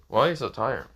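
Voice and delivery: usual speaking voice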